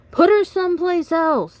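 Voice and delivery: high, loud team shout; annoyed loud shout. Acted. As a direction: yell it at the coach in frustration. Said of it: "they shouted".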